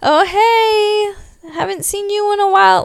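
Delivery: high, forced awkward hello; fake-nice greeting to someone you avoid. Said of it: "one of those awkward ones".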